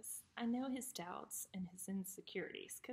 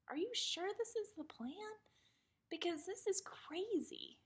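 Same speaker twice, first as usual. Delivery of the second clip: much higher, incredulous begging voice; pleading Moses; 11 semitones higher, 4 dB louder than usual. Retold or imitated. Moses in the desert pleading with the Lord, high disbelieving plea. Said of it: "he kinda pleads with the Lord".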